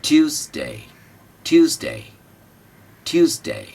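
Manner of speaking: ट्यूसडे with the first syllable lifted high; ट्यूसडे, first syllable up and stressed; Tuesday stress. Shown as a demonstration. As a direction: raise ट्यू in ट्यूसडे. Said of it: "ये उठेगा".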